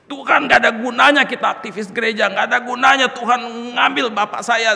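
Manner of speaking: loud, high, anguished outcry at God; angry crying. Retold or imitated. Bereaved son railing at God alone in bedroom, angry crying. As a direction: retell a furious, grief-stricken tirade. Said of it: "Marah, nangis".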